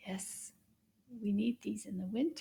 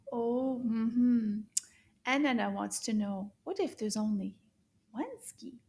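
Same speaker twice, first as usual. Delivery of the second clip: ordinary talk, nothing marked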